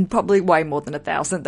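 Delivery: ordinary talk, nothing marked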